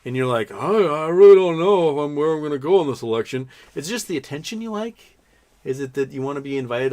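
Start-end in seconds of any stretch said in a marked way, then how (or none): 0.5-3.5 high, loud mock dithering; mock indecision. Host mocking an undecided voter, exaggerated dithering. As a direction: whine it out as a hapless waverer.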